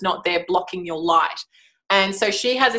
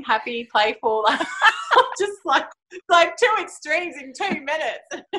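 laughing; 7 semitones higher than usual. Real, not acted. Laughing about kids' mood swings.

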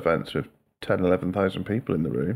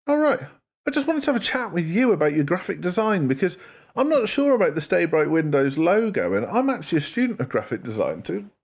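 very high, put-on sales patter; mock the telesales spiel straight back; exaggerated high-pitched salesman voice; parroting the cold caller; 11 semitones higher than usual. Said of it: "I just go back and be like".

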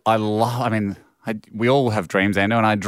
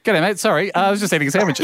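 high, breezy offhand excuse; lightly hammed-up lazy mate; casual Aussie bloke voice; 8 semitones higher, faster than usual. Voicing Russell's casual excuse.

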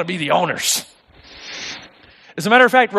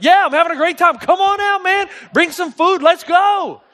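much higher, calling across the yard; cheerful shout; 8 semitones higher than usual. Retold or imitated. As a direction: shout the invite, carefree and oblivious. Retold happy shout to owners.

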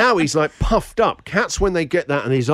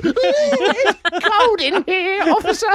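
mock victim; much slower than usual. Mock SAS interrogation.

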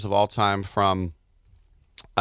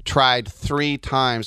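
ordinary talk, nothing marked

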